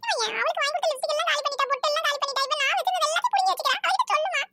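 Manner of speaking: high, fast tattling to mum; complaining. Acted. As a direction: whine rapidly about your sister. Girl complains to mother about sister. (அம்மாவிடம் உயர்ந்த குரலில் வேகமான புகார்)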